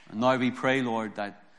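ordinary talk, nothing marked

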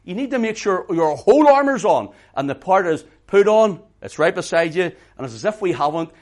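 loud, driving sermon voice; full-force preaching. Acted. Preacher urging the congregation to arm themselves, loud and driving. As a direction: push every phrase out to the back row.

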